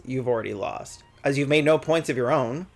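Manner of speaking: usual speaking voice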